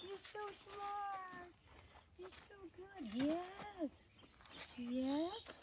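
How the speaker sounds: high, soft, drawn-out cooing; cooing praise. Real, not acted. Rider praising her horse after his steps, cooing praise. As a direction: coo slowly to a beloved animal.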